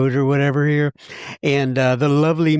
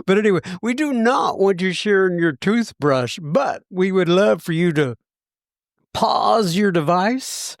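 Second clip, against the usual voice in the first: announcer mode; 4 semitones higher than usual. Acted. Back into host mode.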